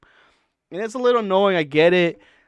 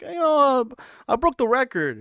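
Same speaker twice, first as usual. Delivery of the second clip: mock boasting; 8 semitones higher than usual. Mimicking Brendan's boast when asked.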